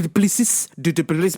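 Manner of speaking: ordinary talk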